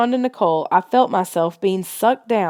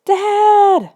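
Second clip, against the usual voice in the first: very high, loud scream for Dad; 13 semitones higher, 6 dB louder than usual. A narrator's reading. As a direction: scream up to the sky. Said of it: "Nicole screamed".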